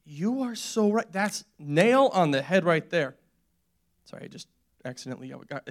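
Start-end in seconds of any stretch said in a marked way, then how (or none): 0.1-3.2 slow, drawn-out, wholehearted agreement; emphatic agreement. Retold or imitated. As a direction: stretch the words as if struck by the truth. Acting out awed agreement with friend.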